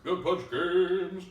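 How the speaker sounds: very slow, drawn-out announcer boom. Acted. Echoing a backer's name, slow announcer voice. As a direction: echo it back as a stretched-out announcer call. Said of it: "in my best announcer voice".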